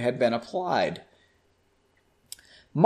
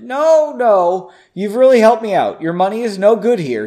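eager insistence; 5 semitones higher, 6 dB louder than usual. Acted. Bartender insists on paying.

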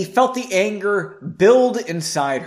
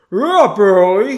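loud, higher, garbled words round a mouthful; mouth full; 5 semitones higher, 11 dB louder than usual. A narrator's reading. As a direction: talk as if chewing toast. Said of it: "said with a mouth still full of toast".

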